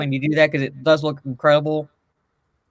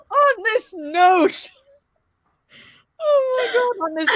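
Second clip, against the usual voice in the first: laughing high. Real, not acted. Laughing at the picture.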